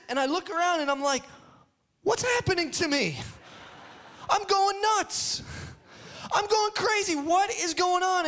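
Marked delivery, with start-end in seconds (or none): 2.0-8.3 mock panic. Dad shocked at his own screaming, in hammed-up panic. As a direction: play up losing your mind, pitched way up.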